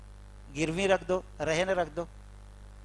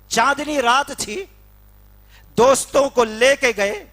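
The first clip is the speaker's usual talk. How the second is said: ordinary talk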